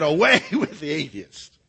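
cheeky, defiant retort; spunky defiance. Acted. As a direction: throw it back at the crowd, spunky. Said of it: "He was spunky".

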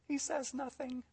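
very soft, whispery aside; hushed. Real, not acted. Preacher on unanswered prayers, in a hush. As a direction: drop almost to a whisper. Hushed punchline about silence.

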